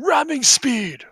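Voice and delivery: loud, high, slow mock Worf shout; Worf battle cry. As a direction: bellow it out like a Klingon at war, hammed up. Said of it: "heard Worf going".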